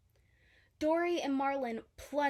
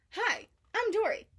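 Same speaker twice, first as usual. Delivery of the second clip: cheerful Dory; 10 semitones higher, 5 dB louder than usual. A narrator's reading. Dory introduces herself.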